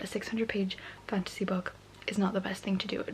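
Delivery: late-night whisper; hushed night-time vlog whisper. Real, not acted. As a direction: whisper so as not to wake anyone.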